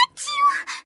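high, sharp sneeze. Acted. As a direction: sneeze from the cold. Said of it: "Miss Grasshopper was so cold".

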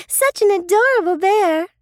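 put-on high, fond girl voice; lightly voiced girl. Sister admiring the sleeping bear, high fond girl. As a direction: coo over the sleeping bear.

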